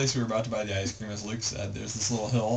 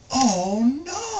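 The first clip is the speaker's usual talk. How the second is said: alarmed cry; 8 dB louder than usual. Retold or imitated. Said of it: "he's like".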